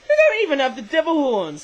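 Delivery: loud, high-pitched protest; indignant complaint. Real, not acted. Toy reviewer complains about missing devil horns, indignant.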